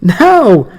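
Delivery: a high, loud, playful "No"; grinning grandmother. Acted. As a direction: tease him with a grin. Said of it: "grinning at him".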